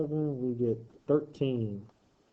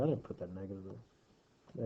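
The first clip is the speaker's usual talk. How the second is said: muttered under breath, much softer; muttering; 5 semitones lower, 11 dB quieter than usual. Real, not acted. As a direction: quietly mutter it to yourself. Self-correction muttered to himself.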